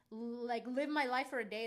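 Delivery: normal talking voice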